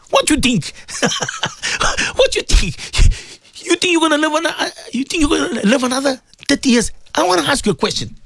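high-pitched challenging questions; impassioned preaching. Acted. Asking listeners if they'll live thirty years, high impassioned preaching.

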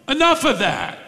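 high, annoyed "Stop it"; indignant protest. Shown as a demonstration. Demon telling Jesus to stop, high indignant protest. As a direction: cut him off, fed up. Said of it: "We could translate it".

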